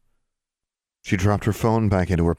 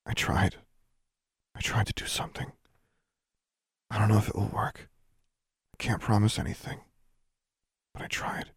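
hushed, breath-only confession; whispered; 3 semitones higher, 6 dB quieter than usual. A narrator's reading. Girl telling her friend she tried to help, whispered. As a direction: whisper it softly, in halting short phrases. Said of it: "and then she whispered".

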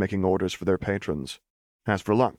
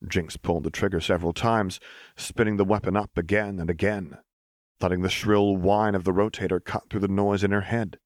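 usual speaking voice

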